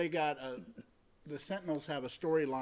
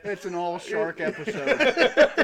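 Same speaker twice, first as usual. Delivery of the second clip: mock announcement; 7 semitones higher, 12 dB louder, much slower than usual. Joking laughing announcement.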